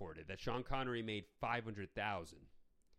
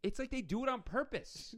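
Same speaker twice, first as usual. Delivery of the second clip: incredulous; 9 semitones higher than usual. Real, not acted. Incredulous rant about the sword.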